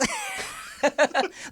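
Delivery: high, light giggle; laughing at his own Michael impression. Real, not acted. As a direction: let a high giggle slip out.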